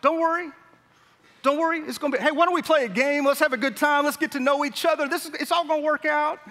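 high, upbeat cheerleader voice; pep up a worried crowd; chirpy host calming everyone, high and bright; bubbly encourager. Retold or imitated. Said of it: "the encourager is gonna be like".

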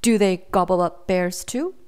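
plain reading voice

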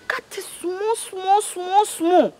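high, whining insistence; mother fretting over costly chicken; distressed plea. Acted. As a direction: beg him to cut it small. Mother pleading over prices.

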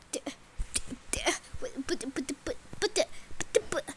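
stammering whisper. Acted. Stammering in shock.

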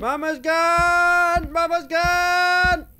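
high, very slow, drawn-out cry; stretched, high-pitched lament; hammed-up wail. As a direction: wail it out in mock despair. Wailing over missing Mama.

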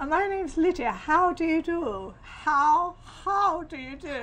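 posh English. Acted. Mother rehearsing meeting his posh family, mock posh English. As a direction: stretch it out in a put-on posh English accent. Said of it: "if he's really posh".

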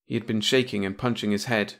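ordinary delivery

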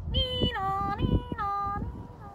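high, two-tone nee-naw; police siren. A narrator's reading. Imitating arriving police siren.

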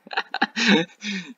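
high burst of giggling; real laugh. Real, not acted. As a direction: giggle helplessly at the memory. Laughing while retelling classmates' joke.

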